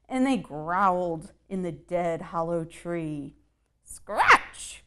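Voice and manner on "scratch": high, sharp cried word; cat mother's cry. Acted. Mother cat cries to her kittens, high and sharp. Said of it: "cried the mother".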